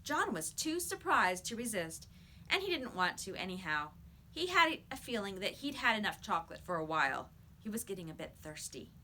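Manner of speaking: plain reading voice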